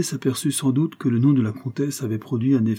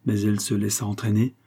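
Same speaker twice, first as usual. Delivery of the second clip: plain reading voice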